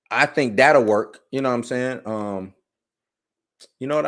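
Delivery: normal talking voice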